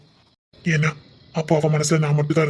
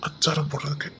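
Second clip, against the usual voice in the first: whisper; 2 semitones lower, 6 dB quieter than usual. Acted. Husband whispering flirtatiously.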